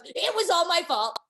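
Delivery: mock wail, half laughing; mocking Heather. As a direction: laugh while mimicking her whine. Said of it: "Heather goes".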